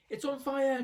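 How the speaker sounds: high loud alarmed yell. A narrator's reading. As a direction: shriek it out in alarm. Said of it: "Grandma yelled".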